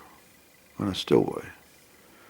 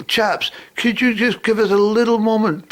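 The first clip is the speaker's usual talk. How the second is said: raised voice, higher, over the party noise; calling out; 13 semitones higher, 6 dB louder than usual. Retold or imitated.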